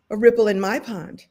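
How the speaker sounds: ordinary delivery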